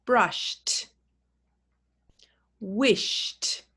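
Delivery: one syllable each, ending in a crisp t. Shown as a demonstration. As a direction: model each word with a clear t ending. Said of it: "you can say it with me".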